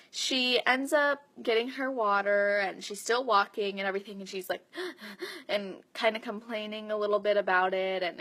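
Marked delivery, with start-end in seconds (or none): 4.7-5.4 quiet, high gasps for air; imitated panting. Client getting water after the run, imitated gasping. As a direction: mimic a winded runner's gasps.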